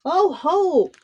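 high gloating call; gloating shark. A narrator's reading. Shark king gloating at dangling Punia, high mocking call. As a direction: crow over trapped prey. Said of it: "the king of sharks called".

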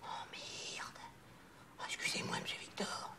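hushed, voiceless apology; mortified whisper. Acted. Assistant apologising to the boss over the button, mortified whisper. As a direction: shrink to a whisper on realising the blunder.

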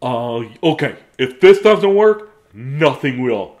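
loud, slow, emphatic declaration; last-ditch determination, drawn out; exasperated resolve. Acted. Character makes last desperate attempt.

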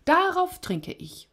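high, drawn-out toast; boastful toast. A narrator's reading. Tipsy king toasts his own boast before the feast, boastful. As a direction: proclaim the toast high and slow.

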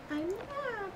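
high sing-song. Pretend. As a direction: chirp it up high and silly. Repeating a silly line in sing-song.

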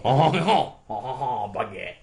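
hammed-up French laugh, then the word; joke French accent. Shown as a demonstration. Friend showing his poor French accent, hammed-up caricature. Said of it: "my French accent just purely consists of".

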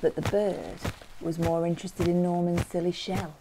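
usual speaking voice

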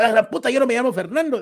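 comic fed-up outburst; mock exasperation. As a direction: snap back like someone fed up with being teased. Acted fed-up reply to heckler.

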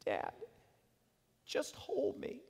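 put-on soft whispered child plea. Son asking his father to hold him, whispered plea. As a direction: barely breathe it, hurting and small.

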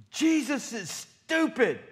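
high, loud, slow yell; mock demonic yell; yelled insult. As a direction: yell it out defiantly. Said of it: "he yells out".

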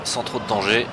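live match commentary, building energy; play-by-play commentary. Acted. Live commentary on his own match.

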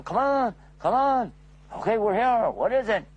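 a light sketch of a gathering crowd; eager crowd. Acted. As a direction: townsfolk hurrying up the hill. Crowd arriving at the hill.

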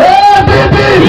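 high shouted party cry; rally slogan call. Real, not acted. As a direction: call out the name for the crowd to answer. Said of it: "ये मैं बोलूंगा MPP".

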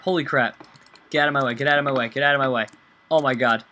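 usual speaking voice

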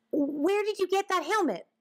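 very high-pitched, eager query; calling question. Acted. Albert calling to woodchuck about helmet, eager. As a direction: call it out high and curious. Boy calls after departing woodchuck.

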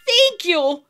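high, loud leprechaun thanks; grateful cry. A narrator's reading. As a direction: cry it out with relief. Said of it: "cried the leprechaun".

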